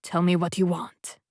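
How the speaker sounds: plain reading voice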